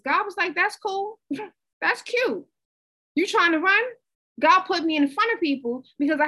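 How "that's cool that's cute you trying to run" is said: higher, amused and teasing, a lightly voiced God. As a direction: play God smirking at her stubbornness. God teasing her, voiced playfully.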